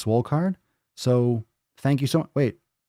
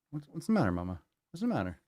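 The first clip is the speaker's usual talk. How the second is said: soothing pet talk; 3 semitones higher, 8 dB quieter, faster than usual. Real, not acted. Soothing the cat.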